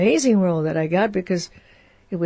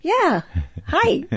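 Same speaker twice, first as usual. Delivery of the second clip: much higher, hopeful chirpy hello; eager hello; 7 semitones higher than usual. Retold or imitated. Hoping for the part, chirping hello to Norman, much higher. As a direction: greet him eagerly with a big smile. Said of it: "I said".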